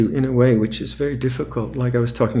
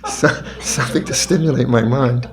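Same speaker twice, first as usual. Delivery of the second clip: laughing. Pretend. Playing the craving mind.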